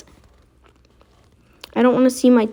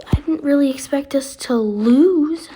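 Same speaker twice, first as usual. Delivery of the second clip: ordinary talk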